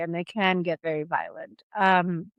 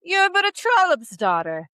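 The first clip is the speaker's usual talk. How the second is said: put-on sneering royal baby; 9 semitones higher, 5 dB louder than usual. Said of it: "the baby replies".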